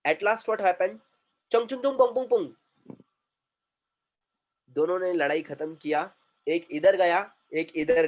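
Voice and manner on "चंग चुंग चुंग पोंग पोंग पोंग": higher, quick mock Chinese gibberish; mock Chinese quarrel. Storyteller replays the fighters' last exchange, mock Chinese quarrel. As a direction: rattle off imitated Chinese-sounding babble. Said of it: "China के अंदर दो लोग थे, वो लड़ रहे थे".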